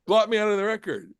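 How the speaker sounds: blot said high and fast; a quick, emphatic Moses plea; urgent plea. Shown as a demonstration. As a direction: push blot me out of the record fast and pleading. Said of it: "So he's saying, "Look".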